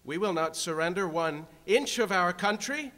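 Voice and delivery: building to a full-throated roar; roaring rallying speech. Real, not acted.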